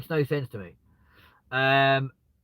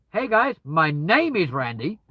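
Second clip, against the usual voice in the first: slower, measured polite introduction; slow careful intro; 3 semitones higher, 5 dB louder, slower than usual. Shown as a demonstration. Meeting new people politely, slow and measured. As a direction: introduce yourself carefully and clearly. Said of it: "Whereas if you go".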